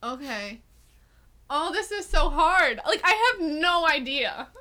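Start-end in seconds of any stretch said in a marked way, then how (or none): none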